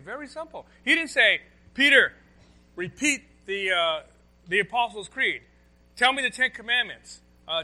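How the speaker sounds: loud, high, driving sermon voice; full-force preaching. Acted. Pastor to congregation, loud full-force preaching. Preaching Jesus' words to Peter.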